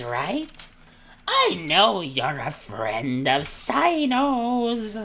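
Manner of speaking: very high, loud, slow figure voice; Dory, scheming squealer. Acted. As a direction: draw out each accusation in a squeal. Plays Dory, high put-on voice.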